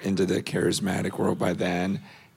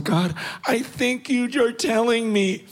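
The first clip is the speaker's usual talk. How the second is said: anxious pleading, much higher; frantic prayer; 12 semitones higher than usual. Retold or imitated. Retelling his anxious silent prayer to God, high and frantic. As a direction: rush the prayer out, pitch climbing in panic. This is all going silently before God because I'm freaking out.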